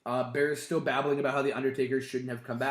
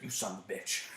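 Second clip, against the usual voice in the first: low muttered curse; 8 semitones lower, 11 dB quieter, faster than usual. Retold or imitated. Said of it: "He's like".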